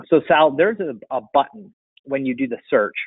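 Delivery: normal talking voice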